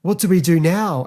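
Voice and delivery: high, loud mock panic; panicked Susan. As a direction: cry it out in hammed-up alarm. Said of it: "Susan's like".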